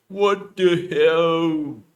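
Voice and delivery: loud drawn-out startled yell; loud scream. Acted. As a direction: scream it slowly in shock. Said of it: "Cannot make high voice".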